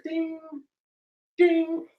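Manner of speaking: high sung ding like a bell; bell ding imitation. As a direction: chime it out high.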